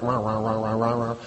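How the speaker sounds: low, droning grumble noise; mock the grumblers in a low monotone mumble; imitated grumbling. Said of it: "That's what it sounds like".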